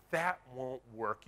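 slow, dismissive "That won't work". Retold or imitated. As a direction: drag it out like a naysayer. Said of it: "with your idea and says".

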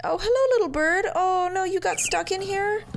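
high, cooing voice for a little bird. Real, not acted. Talking to a stuck little bird in high baby talk. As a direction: coo at the tiny creature like a pet. Greeting a trapped bird in game.

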